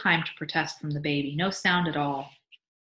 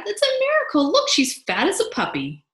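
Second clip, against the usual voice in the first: thrilled, high-pitched astonishment; amazed cry; 12 semitones higher, 6 dB louder than usual. A narrator's reading. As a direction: exclaim over a wonder. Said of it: "Albert cried".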